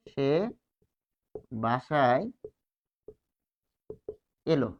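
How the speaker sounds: সে বাসায় এলো spaced out, এলো with e kept and lo; slow word by word. Shown as a demonstration. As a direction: read it slowly, word by word. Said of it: "সে বাসায় এলো যখন আমরা বলছি". (ধীরে ধীরে আলাদা করে উচ্চারণ)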